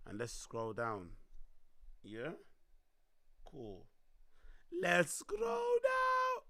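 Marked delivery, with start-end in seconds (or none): 4.8-6.4 high, slow, strained shouting. Real, not acted. Streamer strains his voice calling to chat. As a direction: stretch it out high until the voice gives. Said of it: "Oh, my voice is gone".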